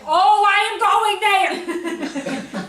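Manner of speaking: slow, loud, high, laughing delight; gleeful laughing exclaim. Retold or imitated. A teacher retelling her delight at hearing of party hell, laughing loudly. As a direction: savour it like great news.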